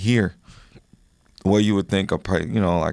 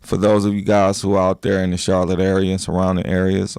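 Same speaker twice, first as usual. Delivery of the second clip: ordinary talk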